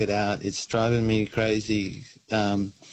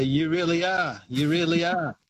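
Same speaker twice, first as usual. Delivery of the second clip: higher, repeated station call; mock station announcement, pitched up; train guy's call; 5 semitones higher than usual. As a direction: lightly imitate a porter calling out the stop. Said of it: "one of the guys would say".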